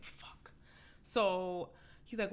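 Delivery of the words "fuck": a dismayed whisper; whispered curse. Retold or imitated. Seeing him outside her parents' house, whispered curse. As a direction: breathe the curse out under your breath.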